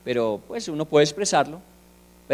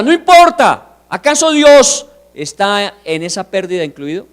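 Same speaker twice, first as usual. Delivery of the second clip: full-force sermon climax; fiery preaching; 6 semitones higher, 9 dB louder than usual. Acted. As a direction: preach it hard to the congregation.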